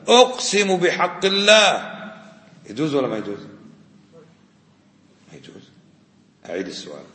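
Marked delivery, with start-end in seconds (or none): 0.1-1.9 slow, ringing, raised-voice oath in imitation; emphatic oath. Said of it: "بعض الناس يا إخوان". (قَسَم بصوت عالٍ ممدود)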